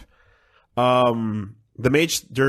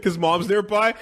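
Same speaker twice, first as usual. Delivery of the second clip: normal talking voice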